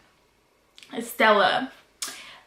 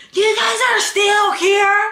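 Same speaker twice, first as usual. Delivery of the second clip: high, loud mock shout of the principal; 10 semitones higher, 4 dB louder than usual. Principal yells at the kids still on campus, loud and high. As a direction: bark it like an angry principal catching them.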